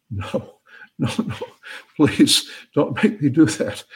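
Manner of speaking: acted begging, rising in pitch; hammed-up reluctant plea; mock pleading. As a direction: plead half-helplessly to be let off. Joke character begs the voice.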